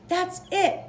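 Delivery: bright, high, certain "that's it"; excited declaration. A narrator's reading. Franklin deciding on his project, an excited declaration. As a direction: announce it like the answer just hit you. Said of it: "declared Franklin".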